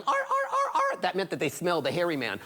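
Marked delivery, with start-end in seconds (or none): 0.0-1.0 high yappy dog barks; dog barking. Acted. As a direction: bark like hounds on the scent. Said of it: "heard the dogs going".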